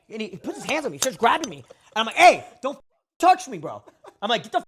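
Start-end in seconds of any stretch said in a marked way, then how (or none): 2.1-3.8 much louder, higher angry yell; retold yell. Yelling at grabbing fan. 4.4-4.6 louder, higher furious threat; retold angry shout. Telling fan to back off.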